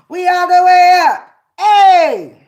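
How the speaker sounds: loud, high cry of triumph; triumphant shout. Real, not acted. Cheering to the viewers, loud triumphant shout. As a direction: shout it out in victory.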